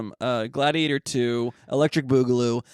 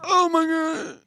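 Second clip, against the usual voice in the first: pained yelp; much slower than usual. Retold or imitated. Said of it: "and I go".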